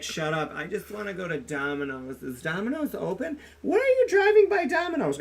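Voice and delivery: put-on petulant drunk, climbing to a high whine; drunk ex-wife. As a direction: imitate a childish drunk demanding food. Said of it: "this is how she talks".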